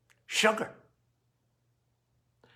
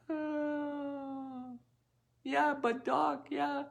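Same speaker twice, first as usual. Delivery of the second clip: higher, much softer sheepish patient; mock patient voice; 9 semitones higher, 10 dB quieter, much slower than usual. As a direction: play a higher, hushed patient dodging the doctor. Patient answering doctor, mock voice.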